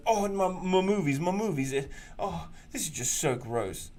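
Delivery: a touch of mocking fan whine; mocking whiny fan. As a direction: whimper over your precious movies. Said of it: "And I'm like".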